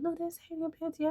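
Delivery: squealing "look, it's her"; excited recognition. Retold or imitated. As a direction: point her out on TV with a thrill. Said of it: "And I said".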